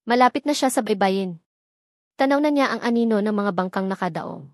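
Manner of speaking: ordinary delivery, nothing marked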